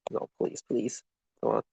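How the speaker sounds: real quiet desperation; whispered plea. Real, not acted. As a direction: whisper your begging under your breath.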